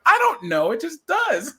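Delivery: high, loud helpless answer; mock exasperation. Helper stumped by learner's English question, mock exasperated. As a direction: throw up your hands, voice climbing.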